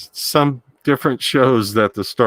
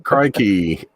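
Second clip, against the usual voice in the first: mock Australian accent. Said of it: "authentic Australian accent".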